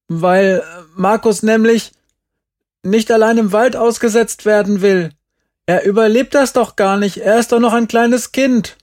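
put-on high, loud child's protest; indignant boy pleading through a door; shouted excuse. A narrator's reading.

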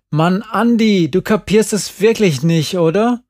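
high, loud exasperated outburst. A narrator's reading. Jerry to clueless Andi about why she cries, exasperated. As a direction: burst out at a friend who won't get it.

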